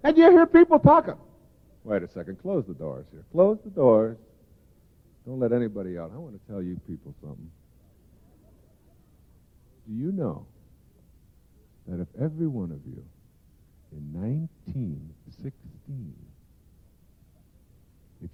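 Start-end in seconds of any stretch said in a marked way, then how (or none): 1.8-12.8 much softer, hushed voice that lets them in on a secret; mock-secretive, softer hush; conspiratorial hush. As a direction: lean in and tell the crowd a secret. Mock secret told to the audience.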